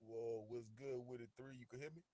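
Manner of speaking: ordinary talk, nothing marked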